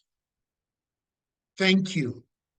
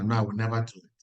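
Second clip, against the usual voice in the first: ordinary talk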